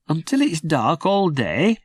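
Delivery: put-on high, puzzled little-boy voice; a small child wondering aloud; small boy Jim. Little Jim asks his sister why it's dark, high boy's voice. Jim's voice.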